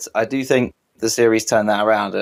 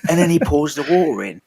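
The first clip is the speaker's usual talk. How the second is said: higher-pitched mock Cockney voice; Billy Butcher impression; 5 semitones higher than usual. Co-host finishing tea gossip to Huey, mock Butcher voice. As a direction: imitate a London tough guy, without real gravel. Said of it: "be more gravelly than that".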